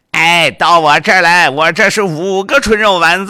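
loud, high vendor's cry; street vendor hawking. Acted. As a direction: lure customers over, loud. Second vendor calls customers over.

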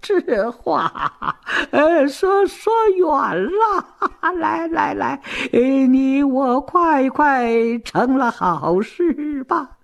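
put-on high, slurred drunk with hiccupy breaks; hammed-up lecherous drunk; drunken mumbling. A narrator's reading. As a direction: slur and drag the words, chuckling tipsily. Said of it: "早已经喝得酩酊大醉".

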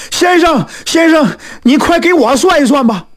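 high, urgent pleading; panicked. Acted. Newcomer rushes in begging for a reading, panicked. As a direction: burst in with a frantic appeal. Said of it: "这个人是惊慌万分".

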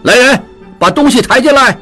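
calling out. A narrator's reading. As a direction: shout for the gifts on the way out. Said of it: "一边喊着". (边走边高声喊人)

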